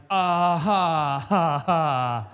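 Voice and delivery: awkward laugh. Retold or imitated. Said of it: "I'm like".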